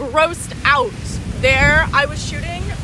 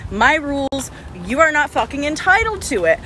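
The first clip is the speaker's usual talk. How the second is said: ordinary talk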